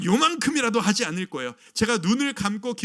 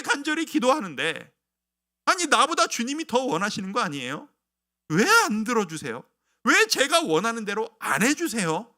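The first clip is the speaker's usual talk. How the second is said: disappointed complaint to God; 6 semitones higher, 5 dB louder than usual. Retold or imitated. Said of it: "라고 하는 이 실망감".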